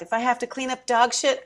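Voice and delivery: ordinary talk, nothing marked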